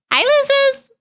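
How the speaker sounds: high squeaky teasing hello; cutesy greeting. Acted. Streamer teasing her chat, high squeaky cartoon hello. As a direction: chirp it like a cartoon.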